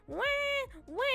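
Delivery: drawn-out imitated cat wail; imitated cat cry. Owner imitates cat demanding attention, mock wail. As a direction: mimic a demanding cat's meow. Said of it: "He's like".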